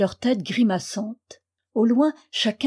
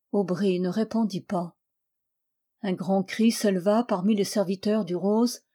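usual speaking voice

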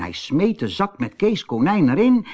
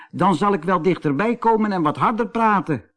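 higher, hurried raised voice; mild storybook shout; 8 semitones higher, faster than usual. A narrator's reading. Kees raises his voice at the silent doll, mild shout. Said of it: "toen hij heel hard schreeuwde".

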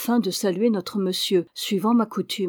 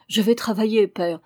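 usual speaking voice

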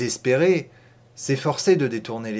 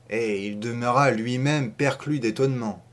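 ordinary delivery, nothing marked

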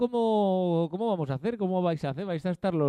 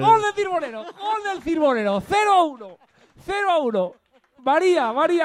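excited goal celebration; 8 semitones higher than usual. Pretend. Host celebrates the narrated goal. (celebración de gol eufórica)

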